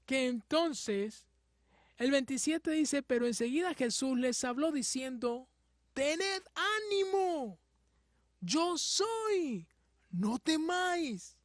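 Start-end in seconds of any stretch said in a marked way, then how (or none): 5.9-11.4 high, slow, reaching call of Jesus. Acted. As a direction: call out to them across the water, slow and high. Jesus calls out to disciples.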